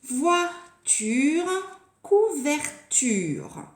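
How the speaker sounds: VOI-TURE, COU-VER-TURE split with clear pauses; syllable by syllable. Shown as a demonstration. Teacher giving example words for a rhyme game, syllable by syllable. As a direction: sound out Voiture and couverture slowly, one syllable at a time.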